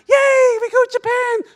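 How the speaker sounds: put-on high, loud kids' cheer; excited shout. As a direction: scream with joy. Kids cheering about the trip.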